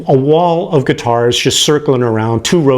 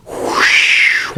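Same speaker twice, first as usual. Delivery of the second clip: breathy whoosh of a reverb tail; imitated reverb whoosh. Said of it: "I hear all this, like".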